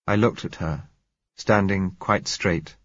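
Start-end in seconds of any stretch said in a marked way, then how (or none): none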